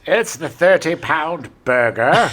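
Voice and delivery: slow hammed-up English accent; put-on British commercial voice; mock British. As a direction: draw it out like a British ad. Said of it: "how well McDonald's does in England".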